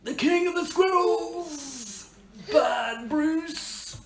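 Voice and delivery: high, slow dramatic reveal; dramatic tree voice. Acted. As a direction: announce the villain grandly.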